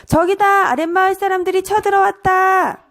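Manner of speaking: high-pitched urgent shout; shouted alarm. Acted. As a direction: cry out a warning to the whole village. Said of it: "고함을 쳤어".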